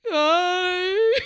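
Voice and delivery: high, loud greeting breaking into a sob; bawling hi. Retold or imitated. Greeting the restoration man, voice breaking into loud sobbing. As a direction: say hi as the tears burst out. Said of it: "Start bawling".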